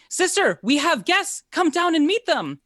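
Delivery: high, loud yell to her sister; summoning shout to someone a floor above; yelling upstairs. Acted. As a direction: holler up through the ceiling in a woman's high voice. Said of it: "she looks up to the ceiling and she yells".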